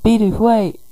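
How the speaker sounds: high-pitched call to wait; calling after someone. Acted. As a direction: call out after a departing friend, voice lifted. Friends call after departing Bidoof.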